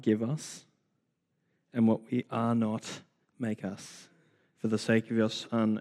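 hushed close to a spoken prayer. Real, not acted. Leads a prayer.

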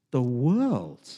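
usual speaking voice

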